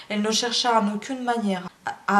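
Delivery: ordinary delivery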